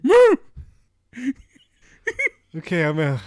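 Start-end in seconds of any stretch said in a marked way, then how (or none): 0.0-0.4 sudden high, loud moo; interrupting cow moo. Retold or imitated. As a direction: blurt the moo to cut in.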